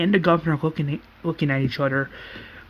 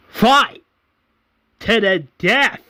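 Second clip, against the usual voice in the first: loud, higher arena proclamation; commanding shout; 6 semitones higher, 5 dB louder, slower than usual. Acted. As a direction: announce a fight to the crowd. Acting governor ordering a fight.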